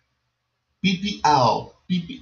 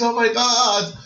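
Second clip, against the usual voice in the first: higher, eager little exclamation; acted surprise; 6 semitones higher, 4 dB louder than usual. Shown as a demonstration. Teacher demonstrating surprise in online chat. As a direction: show shock on the phrase. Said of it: "it's a surprise".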